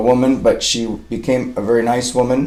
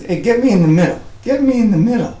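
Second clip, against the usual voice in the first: dog's voice pitched much higher; playful puppy voice, rising higher; voicing the dog; 11 semitones higher, faster than usual. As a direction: speak for the puppy in a high, pleading voice. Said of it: "She says".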